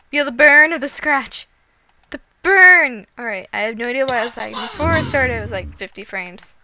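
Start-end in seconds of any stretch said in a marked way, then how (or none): 0.1-3.1 louder building battle cry; dramatic hyped shout. Pretend. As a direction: shout it like an anime attack. Hyping his Pokemon's attack.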